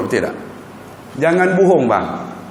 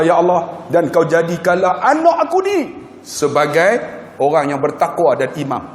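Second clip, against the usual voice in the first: ordinary talk, nothing marked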